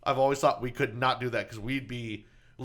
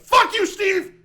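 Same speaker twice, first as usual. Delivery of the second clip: high, loud enraged yell; furious comeback; 8 dB louder, much slower than usual. Acted. Contestant cursing out the game show host, furious shout. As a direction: explode at the host. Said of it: "I'd be like".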